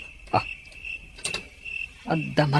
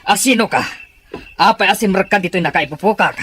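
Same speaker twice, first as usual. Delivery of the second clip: higher, louder startled questioning; 7 semitones higher, 7 dB louder than usual. Acted. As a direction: demand who's there in alarm. Captive alarmed by intruder.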